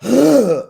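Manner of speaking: loud high bratty gasp; hammed-up bratty gasp; mock spoiled-kid gasp. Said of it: "You did a ... like a little old spoiled kid".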